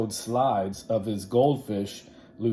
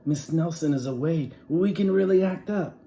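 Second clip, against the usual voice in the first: higher and louder, gleeful; excited schoolkids; gleeful kids; 8 semitones higher, 5 dB louder than usual. Acted. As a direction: kids plotting mischief, excited.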